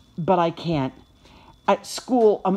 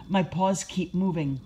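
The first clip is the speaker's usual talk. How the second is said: ordinary delivery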